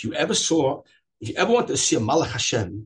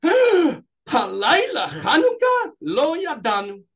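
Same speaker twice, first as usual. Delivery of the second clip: much higher, louder, slow; astonished cry; 13 semitones higher, 7 dB louder, much slower than usual. Retold or imitated. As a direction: cry it out in shocked surprise. Tourists suddenly call out.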